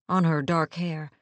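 plain reading voice

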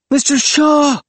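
very high, loud scream. A narrator's reading. Willis screams for the burning old man, screaming. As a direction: scream the name out of a nightmare. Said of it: "screamed Willis".

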